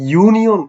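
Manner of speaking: ইউনিয়ন with a loud, high you; you sound stressed. Shown as a demonstration. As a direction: punch the you at the start of ইউনিয়ন. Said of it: "ইউর মতো হয়েছে প্রথম থেকে".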